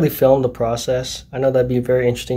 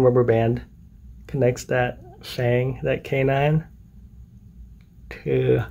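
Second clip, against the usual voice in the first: speaking with finger in mouth; talking with lip stretched open; lip pulled aside by finger; 5 dB quieter than usual. Real, not acted. Seen 0: finger hooks lip corner back, showing braces, while speaking.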